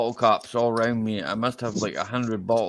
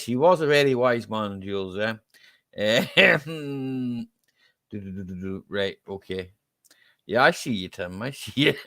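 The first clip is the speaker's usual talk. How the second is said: ordinary talk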